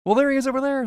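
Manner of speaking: high, loud eager spotter voice; mock excited onlooker. Onlookers at the lagoon pointing at fish, hammed-up excitement. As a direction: squeal like a gullible gawker.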